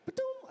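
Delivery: high chirpy ba-doom like a phone alert; mock notification chime. High imitated phone alert waking him at dawn. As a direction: sing the app ping up high.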